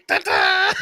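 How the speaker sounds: high-pitched, playful ta-da; mock waking-up cry. Comic telling friends how he wakes up, mock waking-up cry. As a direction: pipe it out high and silly. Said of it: "I was like".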